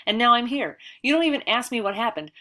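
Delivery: tearful angry outburst. A narrator's reading. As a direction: yell it through tears at the headmaster. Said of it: "I slap angrily at my tears".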